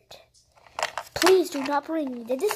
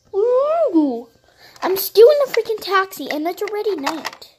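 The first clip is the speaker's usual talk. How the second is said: frustrated; 6 semitones higher, 6 dB louder than usual. Pretend. Frustrated phone call with mom.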